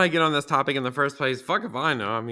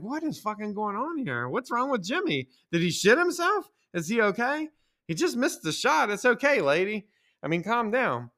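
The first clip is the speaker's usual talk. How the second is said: exasperated disbelief; 7 semitones higher than usual. Retold or imitated. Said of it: "I'm like".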